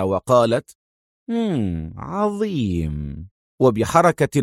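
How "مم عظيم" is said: low, soft, very slow "great"; sinister satisfaction. Acted. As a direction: savour the moment coldly. Villain pleased before murder.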